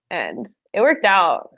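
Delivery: ordinary talk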